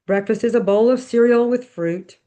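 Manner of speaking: usual speaking voice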